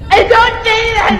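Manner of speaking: high, loud protest. Real, not acted. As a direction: shout it down. Objecting to friend's remark.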